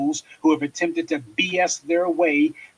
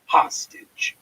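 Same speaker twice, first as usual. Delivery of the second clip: breathy mock whisper on hostage; stage-whispered hostage. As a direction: hush the word hostage. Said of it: "he just said he would hold the vote".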